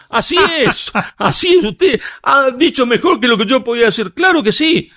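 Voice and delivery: high-pitched, laughing así es; gleefully concede, laughing high; laughing mock agreement. Speaker to the student at the conference, laughing high in mock agreement. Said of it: "le digo".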